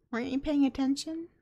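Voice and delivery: ordinary talk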